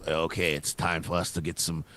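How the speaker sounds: low, quiet, plotting tone; scheming foreign powers. Retold or imitated. Comedian voicing Russia and China eyeing land, sly low villain. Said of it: "They might go".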